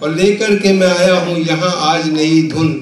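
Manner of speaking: measured, projected Urdu verse recital; comic poet reciting with rhythmic phrasing; mushaira recitation. Acted. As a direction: declaim the couplets to a live audience, line by line.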